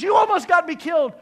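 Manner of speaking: high, furious outburst; angry shout. Acted. Mouse back on shore blames frog, angry shout. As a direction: shout the accusation at full voice. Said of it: "He's angry with the frog".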